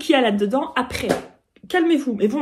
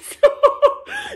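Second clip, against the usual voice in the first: éclat de rire très haut; rire aigu ravi; 6 dB louder than usual. Real, not acted. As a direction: rire de pure joie devant le cadeau. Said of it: "tellement c'est beau". (rire suraigu et émerveillé)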